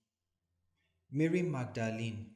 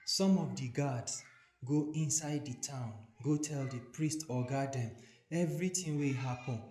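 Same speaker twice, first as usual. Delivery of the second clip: ordinary delivery, nothing marked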